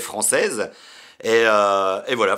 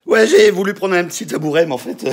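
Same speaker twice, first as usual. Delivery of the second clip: laughing; 7 semitones higher, 7 dB louder, faster than usual. Real, not acted. Stool wobbles during the greeting.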